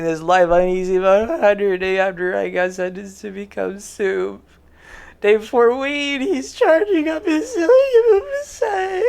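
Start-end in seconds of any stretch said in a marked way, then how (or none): 5.2-9.0 much higher, voice breaking into a squeak; high, cracking and overexcited. Acted. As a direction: squeak it out, nearly losing it. Reading comments, voice cracks high.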